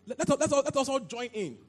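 loud, high, stammering plea; eager outburst. Acted. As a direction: burst out clamouring to be let in. Eager disciples urging to be included.

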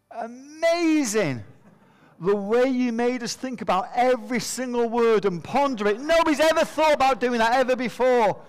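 moved headmaster. Retold or imitated. Headmaster walks up to the boy, hammed-up gushing praise. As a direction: stretch amazing out, voice climbing high.

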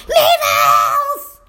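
high-pitched mock holler; mimicked kid shout at full stretch; imitating yelling student. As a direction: yell it back like an overexcited pupil. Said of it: "walking around the room yelling".

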